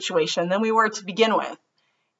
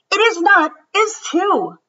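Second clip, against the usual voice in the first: put-on higher, louder childish back-and-forth; kid's quarrel; 12 semitones higher, 7 dB louder than usual. Ruthie and Martin bicker over the camera, loud and higher.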